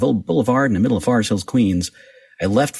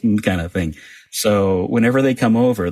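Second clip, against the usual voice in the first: ordinary talk, nothing marked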